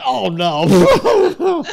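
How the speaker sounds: high, loud wail into laughter; re-enacted collapse into giggles; breaking up completely. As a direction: lose it mid-read, helplessly. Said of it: "I just broke up completely".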